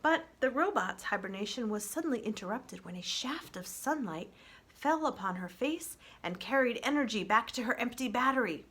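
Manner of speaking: ordinary delivery, nothing marked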